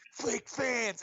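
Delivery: high-pitched mock heckle; drunk fan yelling. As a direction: imitate a drunk fan taunting the crowd. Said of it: "all he's doing is yelling".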